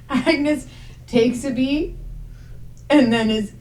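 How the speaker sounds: normal talking voice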